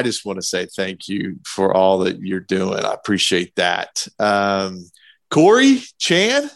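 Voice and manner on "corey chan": high, bright call to the guests; calling out names. Real, not acted. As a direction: call their names out eagerly.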